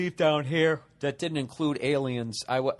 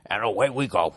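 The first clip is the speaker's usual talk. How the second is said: lower, badly put-on presidential voice; Nixon; 4 semitones lower than usual. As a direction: do a poor impression of a president, pitched down. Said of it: "That was a bad Nixon impression".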